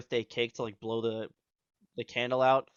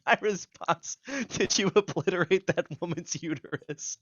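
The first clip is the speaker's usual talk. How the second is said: high, laughter-choked delivery; cracking up while quoting your own text; laughing; 5 semitones higher than usual. Real, not acted. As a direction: struggle to get the punchline out through laughter. Laughing through the punchline of story.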